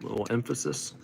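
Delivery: normal talking voice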